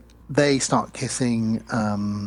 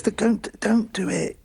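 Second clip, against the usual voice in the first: mock pleading; 9 semitones higher, slower than usual. Retold Tiffany, slow high.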